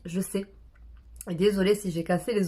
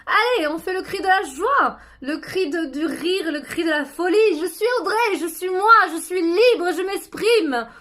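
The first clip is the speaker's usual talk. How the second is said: much higher, louder cries of freedom; joyful shout; 9 semitones higher, 6 dB louder than usual. Shown as a demonstration. Audrey crying out to the moon, joyful shouting. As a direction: shout it out with all your joy. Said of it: "Alors un cri".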